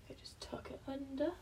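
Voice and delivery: soft whispered instruction; quiet focused whisper. Real, not acted. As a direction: whisper it to yourself. Whispering while working.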